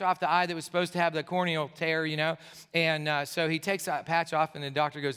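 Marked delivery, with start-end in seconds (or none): none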